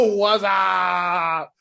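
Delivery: long, drawn-out shouted catchphrase; goofy imitation of a movie yell; mock catchphrase yell. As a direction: holler it and stretch the last vowel out.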